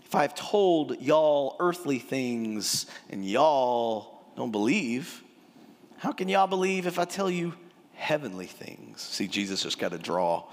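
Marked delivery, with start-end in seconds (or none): 0.0-9.0 Texan drawl. Shown as a demonstration. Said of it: "this is Jesus' Texan".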